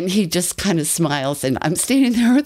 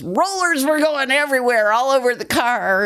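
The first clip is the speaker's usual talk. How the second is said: brother's voice, pitched higher, lightly done; imitating brother; 9 semitones higher than usual. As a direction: she plays her brother laughing at the memory. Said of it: "when my brother used to tell this story, he goes".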